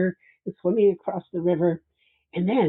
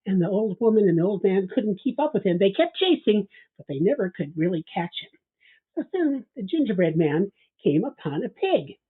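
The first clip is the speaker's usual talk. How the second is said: plain reading voice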